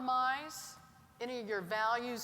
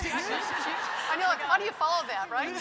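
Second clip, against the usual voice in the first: laughing while speaking, higher and louder; amused moderator laughing after a speech she can't top; 5 semitones higher, 5 dB louder than usual. Real, not acted. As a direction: get the words out through real laughter.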